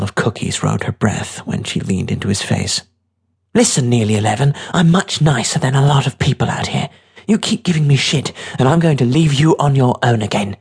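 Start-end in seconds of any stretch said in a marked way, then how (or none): 3.5-10.6 slightly raised, firm warning; a hint of a hard, fed-up girl; lightly voiced tough girl, threatening. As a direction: lay down the law to a kid.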